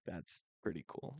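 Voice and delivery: soft, low deadpan; quiet. Retold or imitated. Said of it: "And I was like".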